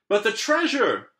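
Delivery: high, loud dismayed holler. Acted. Captain hollering about the treasure, dismayed. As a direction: wail it out for the treasure. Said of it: "hollered Braid Beard".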